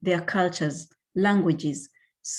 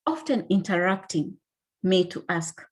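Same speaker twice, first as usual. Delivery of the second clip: ordinary talk, nothing marked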